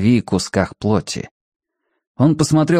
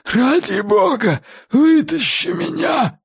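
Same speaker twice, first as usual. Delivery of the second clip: high, slow, strained plea; desperate begging; slower than usual. Acted. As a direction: beg for your life, gasping. Drowning man begs for rescue.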